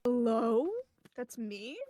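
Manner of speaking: ordinary talk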